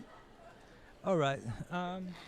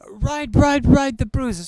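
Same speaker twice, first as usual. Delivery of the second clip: much higher, louder grumbling caricature; exasperated doctor; 7 semitones higher, 5 dB louder than usual. Acted. Doctor dismissing the bruised daughter, high exasperated mock. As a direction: sneer at the whiny teen.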